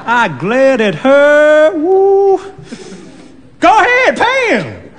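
high, loud exasperation; mock glare. Narrator and old woman at the counter, high and loud. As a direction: ham up the shock.

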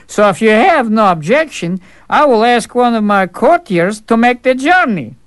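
a brash fool savouring every word; cheeky fool. Acted.

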